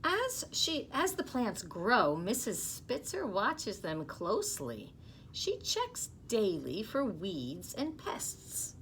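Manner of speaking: storybook reading voice, lively; gentle read-aloud to young listeners; reading to children. Acted. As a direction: read it to the class like a picture book. Teacher reads picture book aloud.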